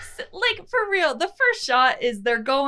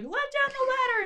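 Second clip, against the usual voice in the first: much higher pleading call; mock urgent order; 4 dB quieter than usual. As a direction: beg for the ladder, hammed up. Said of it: "they're like".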